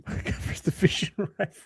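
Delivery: whispered aside with a laugh; whispered echo. Pretend. Co-host echoing the conference division rival phrase, whispered. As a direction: echo it softly, all whisper.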